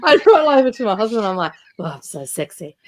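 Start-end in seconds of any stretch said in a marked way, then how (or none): 1.8-2.8 low, softer mock-sexy man's voice through a laugh; mock manly voice. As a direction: tease your husband in a put-on deep voice. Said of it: "my lovely manly voice first thing".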